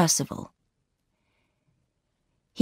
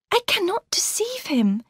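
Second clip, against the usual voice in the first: put-on higher, gentle young woman's voice; Laura's voice; 13 semitones higher than usual. A narrator's reading. Laura greeting her sister warmly, higher voice. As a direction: lift into the young bride's voice.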